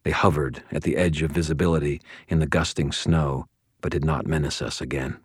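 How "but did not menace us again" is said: ordinary delivery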